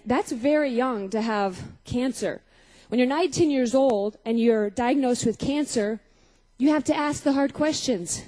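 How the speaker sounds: ordinary talk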